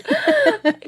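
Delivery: sudden squealing laugh; high burst of laughter. Real, not acted. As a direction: crack up high. Cracking up at the name.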